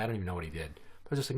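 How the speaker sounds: usual speaking voice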